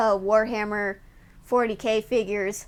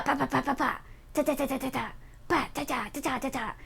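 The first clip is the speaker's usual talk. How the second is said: soft paw pat noises; 6 dB quieter, faster than usual. Pretend. Softer paw-pat sound effects.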